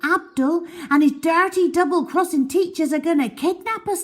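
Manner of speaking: high, loud, urgent warning; excited alarm. A narrator's reading. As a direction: raise the alarm to the others. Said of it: "I yelled".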